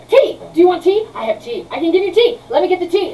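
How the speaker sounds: high, loud, eager burst about tea; eager offer. Acted. Flustered inventor offering tea to his guests, high and eager. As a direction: blurt it out like a host desperate to please. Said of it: "and he goes".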